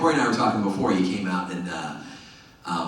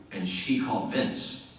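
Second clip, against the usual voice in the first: normal talking voice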